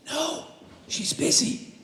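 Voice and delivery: put-on high, hushed little-kid voice; a small child guarding the phone; child's voice. Child tells the caller his mother is busy, high and hushed.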